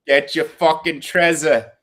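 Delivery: slow, mock Trenton dockworker accent; New Jersey longshoreman. Riffing on cohost's Northeast talk, mock Jersey accent. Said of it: "talking like a longshoreman from Trenton".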